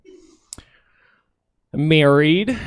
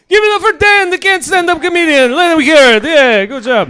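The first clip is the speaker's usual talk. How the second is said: excited host cheer; 12 dB louder than usual. Real, not acted.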